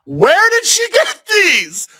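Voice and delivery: very high, drawn-out disbelieving cry; hammed-up high astonished shout; incredulous shriek. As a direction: shriek it slowly in comic outrage. Incredulous punchline about child's bracelets.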